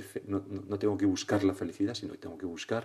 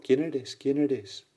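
higher call repeated like an echo; imitated echo; 5 semitones higher, 4 dB louder, much slower than usual. Mountain echo answers the boy, imitated echo. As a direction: bounce the question back, slightly softening. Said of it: "Y de nuevo el eco".

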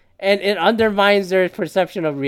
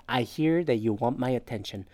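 soft, much quieter and lower reading; gentle therapist phrasing; 6 semitones lower, 10 dB quieter than usual. Retold or imitated. Reading the expert's suggested line in a soft, quiet voice. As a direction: read it like a calm counselor. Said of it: "what to say instead?".

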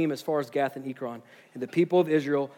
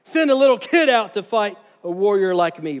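angry Goliath; 4 semitones higher, 6 dB louder than usual. Acted. Goliath scolds Israel for sending a kid, loud and furious. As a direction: roar it like an insulted giant. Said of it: "he's even more angry now".

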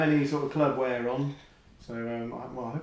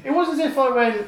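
loud retelling; 9 semitones higher, 4 dB louder than usual. Retold or imitated. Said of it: "you're gonna have to take these sound levels down".

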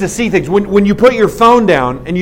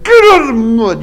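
imitated angry yell, higher pitched; mad sponsor; 4 semitones higher, 4 dB louder than usual. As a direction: bark it like a furious sponsor. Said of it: "the sponsor was so mad".

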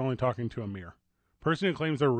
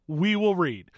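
ordinary talk, nothing marked

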